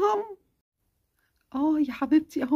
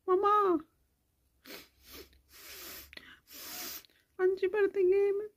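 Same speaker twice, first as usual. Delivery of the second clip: put-on sick little girl; 3 semitones higher, 5 dB quieter than usual. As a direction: feverish child complaining to mama. Sick child whining to mother.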